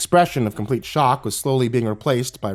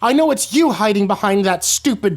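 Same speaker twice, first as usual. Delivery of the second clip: put-on higher, louder, accusing girl; threatening hiss; 9 semitones higher, 4 dB louder than usual. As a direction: snarl the accusation, pitch rising.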